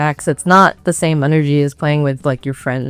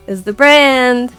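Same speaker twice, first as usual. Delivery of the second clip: stretched out, higher and louder; drawn-out punchline; 6 semitones higher, 5 dB louder, much slower than usual. Real, not acted. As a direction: draw the words out with mock grandeur. Joking sum-up, drawn out.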